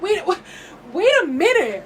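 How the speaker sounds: high, loud disbelieving protest; taken aback. Retold or imitated. Retelling her reaction to the breakup news, taken aback. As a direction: stop them short, stunned and high-pitched.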